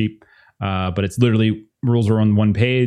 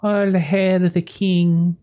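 mumbling madwoman; 6 semitones higher, slower than usual. Acted. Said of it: "starts mumbling random stuff".

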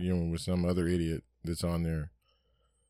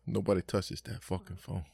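softer, then whispered order; mock stern father hush; 5 dB quieter than usual. Father warning the family off the phone, hushed whisper. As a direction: hiss the rule at the kids.

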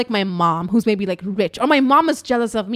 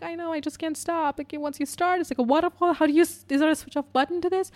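high, soft tearful whine; mock crying voice, small and high; imitated crying; 7 semitones higher, 3 dB quieter than usual. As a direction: act helplessly weepy, pitched up. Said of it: "I was crying".

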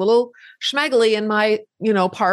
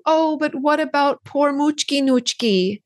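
a lightly whiny, higher voice of misplaced pity; pitiful inner voice; 6 semitones higher than usual. Retold or imitated. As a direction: voice the guilty little thought, pleading and higher. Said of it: "every time your brain goes to".

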